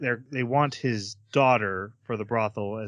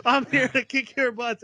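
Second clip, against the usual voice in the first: higher and brash, a laugh in the middle; cocky Mugen; 10 semitones higher, 3 dB louder than usual. Retold or imitated. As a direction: barge in swaggering, grinning. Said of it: "He's like".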